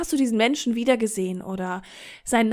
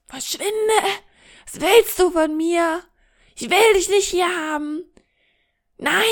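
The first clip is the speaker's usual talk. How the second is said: mock yell, stretched words, pitched up; angeschrien; 5 semitones higher than usual. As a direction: yell at them to get out, acted. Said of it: "dann schreit sie dich einfach an". (gespieltes Anschreien)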